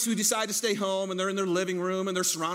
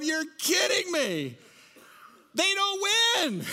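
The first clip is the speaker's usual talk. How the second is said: much higher, slow, whining "You're kidding me"; whiny disbelief; 10 semitones higher, slower than usual. Retold or imitated. Lions draftee complaining to the room, high slow whine. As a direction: stretch it out in a high, pleading whine. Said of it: "sitting there going".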